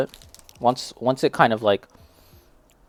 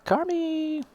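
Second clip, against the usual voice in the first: calling out; 4 dB quieter than usual. Real, not acted. Calling out to someone.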